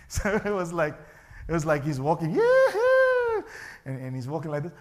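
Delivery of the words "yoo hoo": high, loud sing-song call; playful yoo-hoo. Acted. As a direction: call out like a kid showing off.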